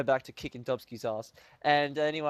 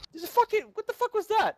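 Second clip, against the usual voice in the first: freaked-out shriek; 4 dB louder, faster than usual. Retold or imitated. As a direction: squeal it way up high, half panicked. Freaking out at mom about UFO.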